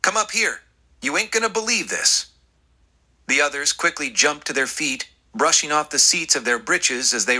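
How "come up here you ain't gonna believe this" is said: high, eager calling out; excited shout calling his friends up the ridge. A narrator's reading. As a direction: holler it, can't wait to show them. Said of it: "he shouted".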